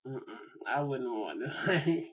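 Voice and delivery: ordinary talk, nothing marked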